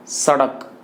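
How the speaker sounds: सड़क said singly with s made at the teeth; dental S demo. Shown as a demonstration. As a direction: model सड़क carefully with a plain dental s. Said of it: "इसका उच्चारण दंत से हो रहा है". (सड़क में दंत्य स)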